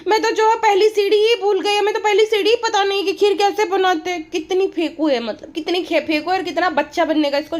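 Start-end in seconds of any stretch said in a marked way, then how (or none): 0.1-4.3 high, sing-song mock of a fake-innocent vlogger; mocking a vlogger who pretends she can't cook; mock childish vlogger. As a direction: ape her put-on girly voice, pitched up. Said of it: "यहाँ पर जो बोल रही है".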